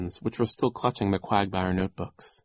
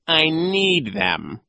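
very slow, loud, higher voice; insistent villain; 8 semitones higher, 6 dB louder, much slower than usual. A narrator's reading. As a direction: stretch out the refusal. Villain refuses to unshoe.

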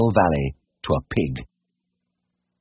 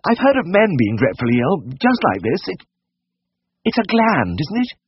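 high-pitched fretting lady; sudden anxious blurt; 14 semitones higher than usual. A narrator's reading. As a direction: blurt out your worst fear in a falsetto lady's voice. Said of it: "then she suddenly blurted out".

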